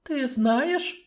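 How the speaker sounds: high, surprised questions; astonished. Acted. Sorceress astonished by the dwarf's secret. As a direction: ask in disbelief. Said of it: "изумлённо подняла брови".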